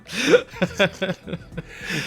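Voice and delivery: embarrassed high-pitched laugh. Real, not acted. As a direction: laugh at yourself. Laughing at own bad accent.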